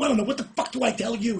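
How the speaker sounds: high, loud frustrated yell; angry yell. Retold or imitated. Yelling back at Kevin in frustration. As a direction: snap back at him.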